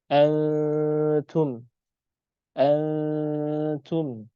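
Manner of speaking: Antum with a thin, light nasal, drawn out; thin ikhfa. Shown as a demonstration. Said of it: "tapi dia tipis". (Antum dibaca tipis dan ringan)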